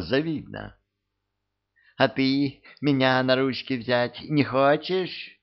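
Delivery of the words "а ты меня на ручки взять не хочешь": lightly voiced pestering plea; high coy wheedling voice. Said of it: "начал приставать он".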